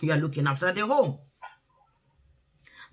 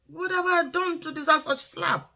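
put-on much higher, indignant child's question; stunned child; 9 semitones higher than usual. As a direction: mimic a slapped kid asking why. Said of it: "And they'll be like".

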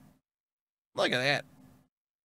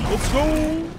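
hyped cheer; 9 semitones higher than usual. Real, not acted. Hyped after a jump.

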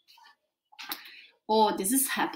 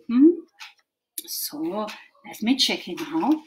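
ordinary delivery

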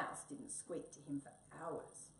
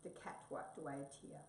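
ordinary delivery, nothing marked

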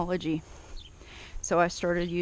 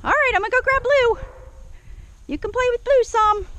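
high, loud promise to the dog; excited doggy talk; 5 dB louder than usual. Real, not acted. Trainer promising Daisy playtime, high and excited. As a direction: tell the dog happy news in a high voice.

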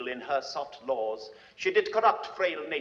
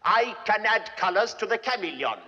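swelling triumphant shout; 7 semitones higher, 3 dB louder than usual. Acted. Richard boasting of his cunning to the audience, ending in a gloating shout. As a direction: grow higher and louder into a gloating shout.

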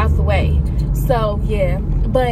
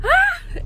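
excited squeal. Real, not acted. Reacts to hearing own voice.

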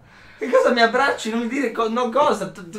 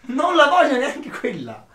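laughing protest; 10 semitones higher, 7 dB louder, slower than usual. Real, not acted. Laughing protest over the focaccina.

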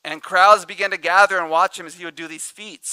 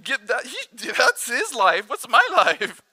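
high, whiny, jealous complaint; whiny Peter; 6 semitones higher, 3 dB louder than usual. Retold or imitated. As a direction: Peter whining about John, pitched up. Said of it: "He's like".

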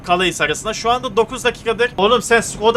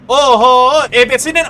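surprised shout; 5 semitones higher, 8 dB louder than usual. Real, not acted. Surprise at the road.

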